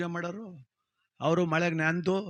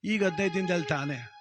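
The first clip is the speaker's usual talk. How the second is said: ordinary talk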